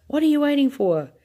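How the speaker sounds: high, loud panicked scream. A narrator's reading. Grandma screaming at Wonka, high and loud. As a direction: keep screaming at him. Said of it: "screamed Grandma Josephine".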